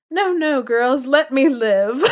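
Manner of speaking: hammed-up plea from a teased bear; bear crying out. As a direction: beg for mercy like a big playful bear. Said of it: "did he cry out".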